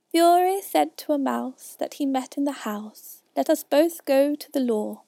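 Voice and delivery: slow, emphatic storytelling in rhyme; measured, projected poem reading; verse recitation. A narrator's reading. Recites the mouse's tale.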